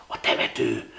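hushed, toneless whisper. A narrator's reading. Ilon outside the dark parsonage, whispering. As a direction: breathe it out secretively.

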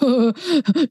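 a short hearty laugh brushing it off; hearty dismissive chuckle. A narrator's reading. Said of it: "winkte Schnarchi lachend ab".

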